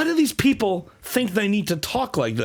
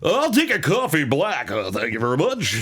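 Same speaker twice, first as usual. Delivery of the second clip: announcer ordering food. Acted. Announcer giving a waitress the order, hammed-up announcer voice. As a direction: sell each menu item like a sports call. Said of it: "imagine he's just ordering".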